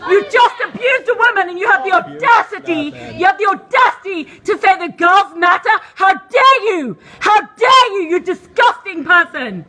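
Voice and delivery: furious shriek; screaming in outrage, voice shaking; shaking with anger. Real, not acted. Protester screaming accusations.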